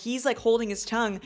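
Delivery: ordinary delivery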